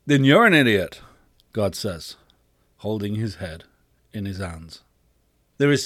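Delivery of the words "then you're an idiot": loud, blunt put-down; exasperated. Acted. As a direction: lose patience and raise the voice. God snaps at the boy.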